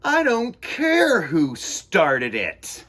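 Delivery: loud, slow scolding; stern teacher. A narrator's reading. Teacher telling David off for gum, slow and stern. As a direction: spell out each word like a strict teacher.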